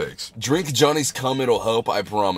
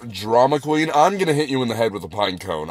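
ordinary talk, nothing marked

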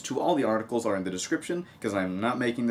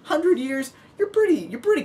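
high, disbelieving question; can't believe the number; incredulous; 4 dB louder than usual. Real, not acted. Vlogger mocking a hundred-year ban to viewers, high disbelieving question.

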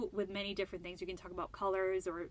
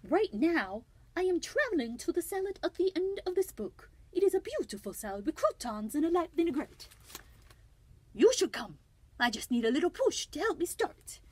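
higher, louder bad French accent; Escargot; 8 semitones higher, 7 dB louder than usual. French snail inviting listeners along to the salad, very bad French accent. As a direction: declaim grandly in a hammed-up French accent. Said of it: "a very, very bad French accent".

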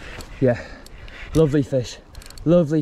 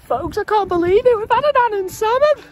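much higher, ringing with joy; elated announcement. Real, not acted. Angler telling his folks about the Annan salmon, elated. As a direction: tell the folks the big news, beaming.

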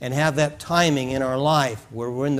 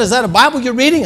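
woman's voice; 10 semitones higher, 4 dB louder, faster than usual. Retold or imitated.

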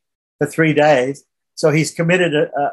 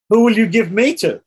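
higher, pointed question with mock innocence; sarcastic son challenging his father; 6 semitones higher than usual. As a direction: needle him with a raised-pitch question. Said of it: "he said sarcastically to his father".

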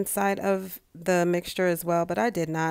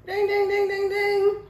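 bell ding chant; 13 semitones higher, 5 dB louder than usual. Pretend. Mock game-show bell.

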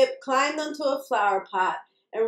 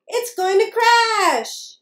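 high, loud, drawn-out warning; stretched high storybook wail; alarmed squeak; 9 semitones higher, 5 dB louder, slower than usual. A narrator's reading. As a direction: cry out slowly in mouse-like alarm. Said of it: "squeaked Sam".

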